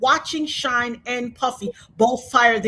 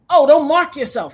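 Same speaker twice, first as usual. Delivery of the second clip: scolding; 3 semitones higher, 8 dB louder than usual. Retold or imitated. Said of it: "they were like".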